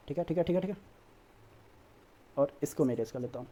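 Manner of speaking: low, soft self-talk while busy; absent, hushed aside while erasing; talking to oneself. Real, not acted. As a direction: say it under your breath, half to yourself. Talking to himself while erasing board.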